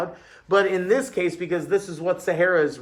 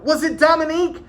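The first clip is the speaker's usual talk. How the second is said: eager guessing; 12 semitones higher, 6 dB louder than usual. A narrator's reading. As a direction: shout the guess like an eager pupil. Pupils eagerly guess the boy.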